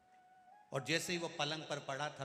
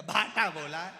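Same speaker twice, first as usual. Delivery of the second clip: much louder sharp answer; angry retort; 3 semitones higher, 12 dB louder than usual. Retold or imitated. Annoyed patient snaps back at the deaf visitor. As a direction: bite it out in irritation.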